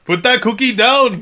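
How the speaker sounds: loud, high attempt at Arnie; Arnold Schwarzenegger impression. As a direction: shout it like Arnie. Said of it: "can't do an Arnold Schwarzenegger".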